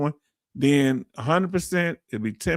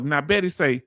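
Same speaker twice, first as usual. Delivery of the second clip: ordinary delivery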